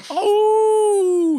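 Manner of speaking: long high howling "oh"; wolf howl. Shown as a demonstration. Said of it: "you do a wolf howl".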